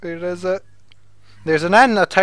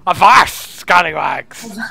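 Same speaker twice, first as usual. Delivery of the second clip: loud pirate bark, scallywags drawn out slow; hammed-up pirate hollering; loud pirate cry; 5 dB louder, slower than usual. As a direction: hail them like a pirate on deck. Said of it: "Pirate talk".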